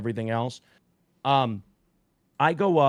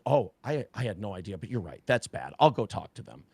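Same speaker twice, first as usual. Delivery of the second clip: lower, softer, offhand; mock casual reply; 5 semitones lower, 4 dB quieter than usual. Wife casually dismissing the drugs, understated. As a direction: play it down like it's nothing. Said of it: "she goes".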